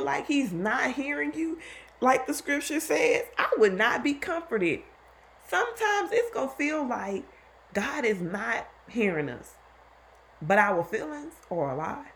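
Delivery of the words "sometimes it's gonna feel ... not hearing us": ordinary talk, nothing marked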